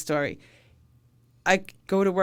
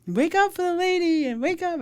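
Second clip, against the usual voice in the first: 1 bystanders yelling; 13 semitones higher, 4 dB louder than usual. Retold or imitated. Bystanders yelling the victim's name.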